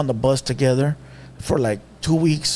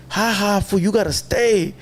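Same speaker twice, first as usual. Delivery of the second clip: higher-pitched imitation of gloating; mock laughing taunt; 5 semitones higher, slower than usual. Uncle about to be released taunts nephew, mock laughing. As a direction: crow it slowly like the uncle. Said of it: "He's laughing at me".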